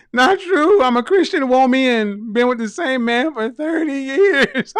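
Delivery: high, loud mocking voice; mock her in a high loud voice; hammed-up imitation of the commenter; mocking quote. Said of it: "She said".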